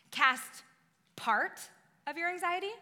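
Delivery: PART set apart, anxiety rising high; questioning. Acted. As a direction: ask "part" in doubt, pausing round it. Mock question to set up correction.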